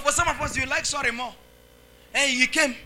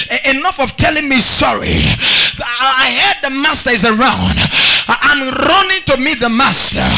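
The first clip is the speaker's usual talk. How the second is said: loud, insistent pulpit delivery; full-force preaching; 2 semitones higher, 8 dB louder than usual. Real, not acted. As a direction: preach the story with rising urgency.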